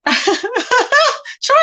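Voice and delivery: high, slow words through laughter; laughing. Real, not acted. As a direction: crack up at a funny comment. Laughs at viewer's comment.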